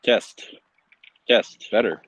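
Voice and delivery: ordinary delivery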